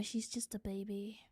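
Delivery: whispered, voice off; tender whisper about her puppy; fond whisper. Real, not acted. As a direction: breathe it softly about the dog. Fond aside about her dog.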